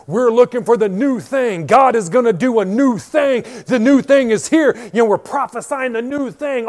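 loud, high, hyped-up caricature; mock prophetic hype. Mocking the prophetic crowd with a loud hyped-up voice. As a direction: belt it out like an overexcited prophet.

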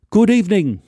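a polite English gent's greeting; English accent. Retold or imitated. English stranger greets narrator at the bar, lightly English. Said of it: "He, he was English".

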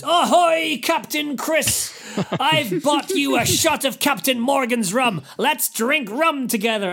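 loud, high pirate-ish fan greeting; rowdy fan. Retold or imitated. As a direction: hail him like a drunk pirate fan. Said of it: "a guy goes".

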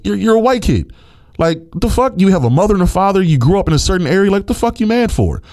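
high, exasperated outburst at the player; heated rant. Real, not acted. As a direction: voice climbing in disbelief and anger.

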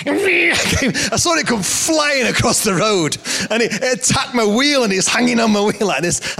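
very high-pitched, excited comic retelling; worked-up, high anecdote voice; animated storytelling. Real, not acted. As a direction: tell it in squealing comic outrage. Excited tale of terrier attack.

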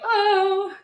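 one long, high, loud cry; mock wail. Former teacher retelling reaction to postcard, mock wail. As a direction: imitate a heartbroken wail.

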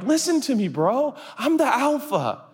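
playful would-be alpha; asserting authority. Acted. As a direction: insist to the dog that you rule. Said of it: "I'm like".

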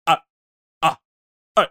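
short, halting isolated syllables; stop-start tunnel garble; William Shatner impression. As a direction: imitate choppy, broken-up speech. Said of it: "William Shatner going through a tunnel".

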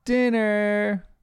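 high, drawn-out mock yell; calling from afar. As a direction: imitate a mom calling the kids in to dinner. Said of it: "she yells".

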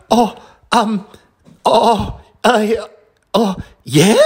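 high, flustered, tongue-tied fan; starstruck stammer. Retold or imitated. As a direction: stammer like you can't believe your luck. Starstruck fan replying, retold.

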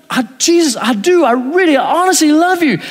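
insist, rising with each phrase; earnest insistence. Acted. Pastor insists to Jesus.

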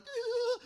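high-pitched mock groan; mocking "ugh" noise. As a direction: imitate mockers with a high, silly noise. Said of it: "and they're like".

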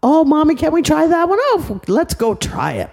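put-on high eager little-kid voice; excited child. Little girl begging Mommy to try the game, high and eager. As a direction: beg Mommy like a small child. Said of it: "Mommy said".

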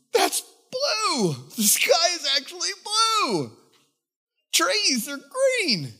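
high, loud cry of wonder, slow at the end; amazed. Retold or imitated. Freed man outside, shouting in awe at the sky. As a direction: shout it as if seeing colour first. Wonder at colours after deliverance.

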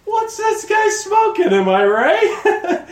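high, loud, slow mocking shout; the NPC Joseph mocks the player, high and loud. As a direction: jeer at him in a high voice, dragging it out. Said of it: "you see Joseph go".

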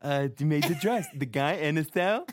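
a mild imitation of a tipsy, slurring Dorinda; drunk Dorinda impression. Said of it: "I know that's a horrible accent".

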